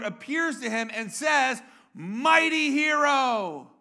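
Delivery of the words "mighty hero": loud, drawn-out heroic hail; booming, stretched-out angelic greeting; angel's proclamation. Acted. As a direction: proclaim it high and slow like an angel. Preacher voices angel hailing Gideon.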